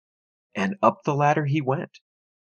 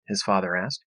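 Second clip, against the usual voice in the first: plain reading voice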